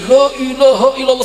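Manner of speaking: high, loud mock-weeping chant; imitated tearful dhikr. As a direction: act out fervent crying devotion. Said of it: "sujud nangis, zikir". (menirukan zikir sambil menangis)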